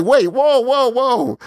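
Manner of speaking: a jolt of surprise at the news; startled whoa. Retold or imitated. Viewer realizing the actor is British, startled high whoa.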